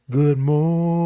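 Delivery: held-back, drawn-out morning; discreet greeting for work. Shown as a demonstration. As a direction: sing it small, as if at work. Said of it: "But you can say".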